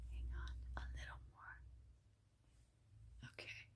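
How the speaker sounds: very soft, half-whispered aside; whispering. Real, not acted. Reader hushed by noises in the kitchen, whispering. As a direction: drop to a hushed near-whisper. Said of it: "I don't know why I'm whispering".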